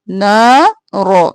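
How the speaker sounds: usual speaking voice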